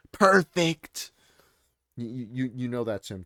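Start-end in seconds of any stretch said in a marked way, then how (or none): 0.1-1.1 loud, high, drawn-out Trump "perfect". Shown as a demonstration. Streamer mimicking Trump's aside in his post. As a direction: punch "perfect" like Trump bragging. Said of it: "he puts parentheses".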